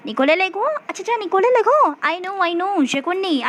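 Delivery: high, quick recognition; Ronal. Acted. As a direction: act out suddenly placing a famous name. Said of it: "अब रोनल क्या बोलते हैं".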